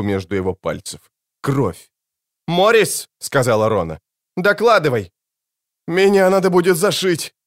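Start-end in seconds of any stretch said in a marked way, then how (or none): 2.5-3.1 high, urgent shout of a name; urgent call. Acted. Officer calling to a hurt colleague, urgent and high. 4.4-5.1 high-pitched urgent order; urgent command. Acted. Officer ordering a hurt colleague to report, high and urgent. 5.9-7.3 high, strained-sounding cry of the injured; pained urgent report. Acted. Injured man reporting to his officer, high and urgent.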